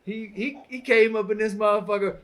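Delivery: loud into the mic, distorting; yelling too close to the mic; clipping distorted mic. Real, not acted. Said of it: "I gotta stop yelling in the mic".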